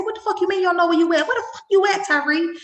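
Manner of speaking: high, fast alarmed questioning; panicked mom demanding where her son is; retelling her frantic phone yell; alarmed mother. Retold or imitated.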